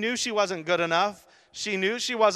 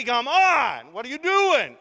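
high and loud, hammed-up frustration; exasperated mock yell; 7 semitones higher, 7 dB louder, slower than usual. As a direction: demand an answer, half-joking. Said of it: "I would start to go crazy".

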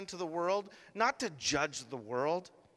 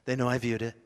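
hurried inner thought; 7 semitones lower, much faster than usual. Retold or imitated. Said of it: "it's that moment on Facebook".

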